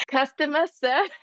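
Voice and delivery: mock the Boston phone voice through laughter; Boston accent. Imitating Boston customer service calls, a laughing Boston accent. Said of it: "real Boston accent".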